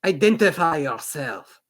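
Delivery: guard's voice. Acted. Guard voice, different timbre.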